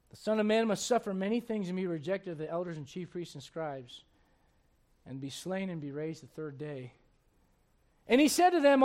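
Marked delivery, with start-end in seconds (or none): 0.1-6.9 scripture reading. Acted. Quotes Jesus, subdued.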